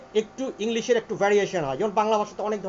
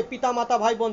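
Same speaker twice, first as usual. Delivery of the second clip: ordinary delivery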